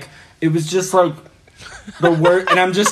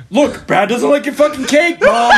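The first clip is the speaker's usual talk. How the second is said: very high, mock-furious yell to mom; hammed-up screechy outburst, rising to a shout; heated; 12 semitones higher, 4 dB louder than usual. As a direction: imitate a worked-up friend tattling at full pitch. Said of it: "so heated, and he was like".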